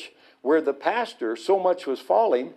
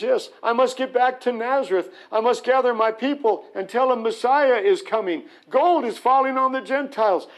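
excited rabbi; 8 semitones higher than usual. Retold or imitated. Rabbi excitedly announcing Messiah coming.

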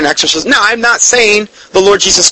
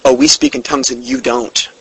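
smug, a bit softer and lower, mocking the proud; mock prideful boast; 6 semitones lower, 4 dB quieter than usual. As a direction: lightly sneer it like a proud believer. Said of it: "they get real prideful".

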